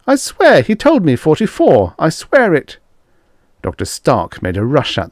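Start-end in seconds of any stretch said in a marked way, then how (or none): 0.1-2.8 very high, loud pleading protest; frantic insistence. A narrator's reading. Grayson swearing to the men that Stark told him, frantically. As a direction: swear it desperately.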